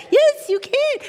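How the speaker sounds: high insistent tempter; coaxing tempter. Acted. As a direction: press it on them, high and sly. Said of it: "acted dialogue".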